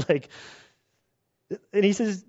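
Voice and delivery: ordinary talk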